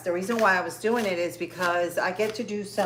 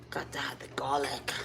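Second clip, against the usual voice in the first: breathy unvoiced whisper, softer; 5 semitones lower, 8 dB quieter than usual. Real, not acted. Cook whispering an aside to viewers, whispered. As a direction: whisper it like a little secret. Aside while dicing garlic.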